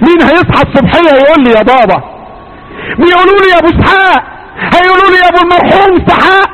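a father's lament rising high and fast; grieving father. Acted. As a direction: cry out the loss of a son, high. Abraham grieving to Isaac.

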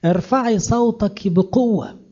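high, slow, ringing call. Acted. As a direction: proclaim the verse with a strongly lifted voice. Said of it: "نادي بصوت عالي".

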